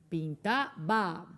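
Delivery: "Pintaba" very slow and low, split before "ba"; syllable-by-syllable demo. Shown as a demonstration. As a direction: set the final "ba" of "Pintaba" apart.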